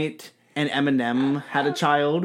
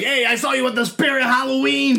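higher, louder mock yell; 10 semitones higher, 4 dB louder than usual. Imagining [name] yelling at fans, hammed-up yell. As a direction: yell it like a spotted celebrity. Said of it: "yell at you and be like".

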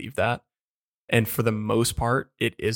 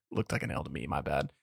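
much softer and lower; quiet aside; 8 semitones lower, 8 dB quieter than usual. Real, not acted. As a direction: mumble it to yourself. Embarrassed aside.

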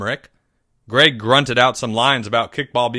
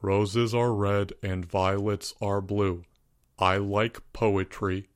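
slow, lower, softer monotone; dull monotone; 4 semitones lower, 7 dB quieter, slower than usual. A narrator's reading. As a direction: recite it bored and flat. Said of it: "spoke in a dull monotone".

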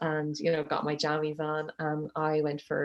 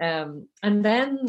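normal talking voice

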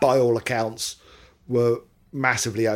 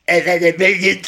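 hammed-up Scottish-ish accent, higher and louder; giggle-tinged mock rock-star voice; 7 semitones higher, 9 dB louder than usual. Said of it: "you can't do that accent".

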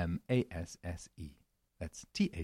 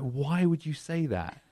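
puzzled Brit; 9 semitones higher, slower than usual. Retold or imitated. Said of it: "they'll be like".

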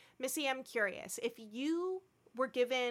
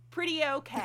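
dry understatement; 5 semitones higher, 4 dB louder than usual. Retold or imitated. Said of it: "he stated that".

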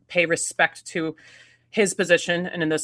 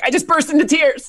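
high, loud mock outburst; retold emotional outburst; 10 semitones higher, 6 dB louder than usual. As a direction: cry it out in hammed-up emotion. Acts out friend's emotional message.

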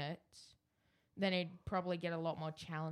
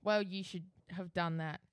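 normal talking voice

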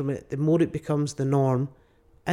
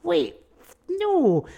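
high, startled wait what; a sudden double take; mock-startled wait what, said high; shocked realization; much slower than usual. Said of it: "you're going".

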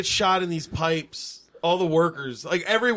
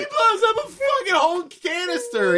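much higher, loud and disbelieving; incredulous outburst; 13 semitones higher, 4 dB louder than usual. Real, not acted. Laughing at a bad movie to friends, high incredulous outburst. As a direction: blurt out the ridiculous payoff. Building to the absurd explosion.

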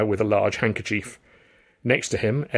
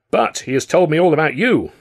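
louder, higher interjection; cutting in; 6 semitones higher, 7 dB louder than usual. A narrator's reading. New manager interrupts the visitors, cutting in loudly. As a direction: break into the conversation brightly and loudly. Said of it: "another voice cut in".

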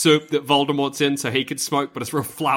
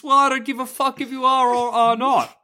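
careless teacher voice; 7 semitones higher than usual. Acted. Teacher character addressing students.

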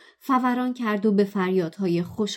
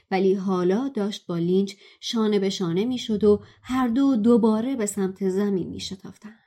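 usual speaking voice

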